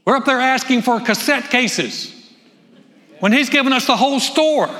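loud, rising preacher's call; full-force preaching. Acted. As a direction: preach it out to the congregation.

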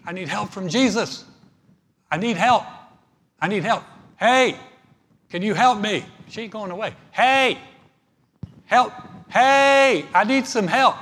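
high, loud pleading calls for help; hammed-up cries of a woman begging the disciples; mock desperate yelling. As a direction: holler for help, insistent.

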